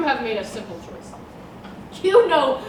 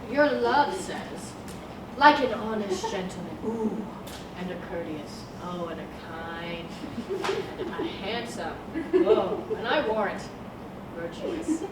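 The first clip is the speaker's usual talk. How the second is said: low, soft and lingering; savouring praise; 8 semitones lower, 9 dB quieter than usual. Acted. Nurse teasing Juliet with Romeo's virtues, savouring praise. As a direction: dwell softly on each virtue, pausing between.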